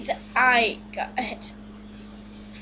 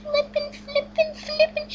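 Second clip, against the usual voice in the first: very high-pitched gleeful babble; ecstatic squealing; 11 semitones higher, 4 dB louder than usual. Real, not acted. Player overjoyed by a trade, high squealing. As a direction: squeal it far above your usual pitch.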